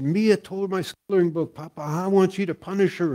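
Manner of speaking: high-pitched mock wail of a little girl; crying granddaughter. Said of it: "she's crying".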